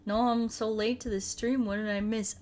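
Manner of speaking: ordinary talk, nothing marked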